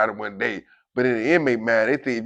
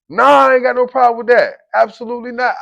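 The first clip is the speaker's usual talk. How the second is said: loud, high protest; over-eager backing down; hasty denial; 9 semitones higher, 8 dB louder than usual. Retold or imitated. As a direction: deny it emphatically, voice raised. Backing down when officer challenges him.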